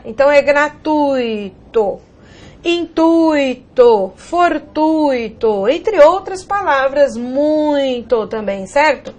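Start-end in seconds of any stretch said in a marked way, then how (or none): 0.4-2.0 "gratuito" slowly drawn out as gra-TUUI-to, strong U, short I; weaker I. Shown as a demonstration. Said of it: "ele tem uma pronúncia menor". 2.6-5.7 "intuito, fortuito" very slowly, each UI stretched with a strong U; stronger U. Shown as a demonstration. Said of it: "Pronúncia mais forte". 7.2-8.3 "muito" drawn out slowly, strong U gliding to weak I; stronger U. Shown as a demonstration. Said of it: "Pronúncia mais forte".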